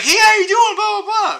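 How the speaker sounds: very high, loud mock-friendly hello; overdone greeting. Shown as a demonstration. Showing passers-by what they needn't do, overdone loud greeting. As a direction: exaggerate a gushing greeting, way up in pitch and volume. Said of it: "you don't have to be like".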